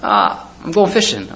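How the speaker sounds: a hint of a carefree twelve-year-old; boy's casual answer. Preacher voicing the boy heading to sea, light and carefree. Boy voiced in imagined scene.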